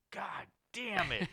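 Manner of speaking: high, slow drawn-out god damn it; exasperated groan. Retold or imitated. Watching the vulgar comic, slow and exasperated. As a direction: drag it out in dismay.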